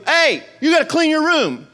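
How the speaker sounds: bossy sibling tone; a hint of a bossy child; kid passing it on. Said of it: "If they go".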